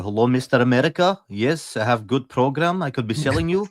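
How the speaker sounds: put-on broken English in a Russian accent; thick Russian accent on a phone pitch. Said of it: "that's a good Russian accent".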